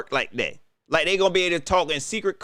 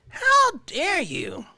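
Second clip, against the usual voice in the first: higher, slow, offended how dare you; mock outrage; 11 semitones higher, much slower than usual. Host fakes offense at the commentator, high and slow. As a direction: stretch it out like a wounded diva.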